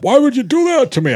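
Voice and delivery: high, wounded mimicry; mock complaint. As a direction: imitate someone whining that they were wronged. Voicing the caller's hurt complaint.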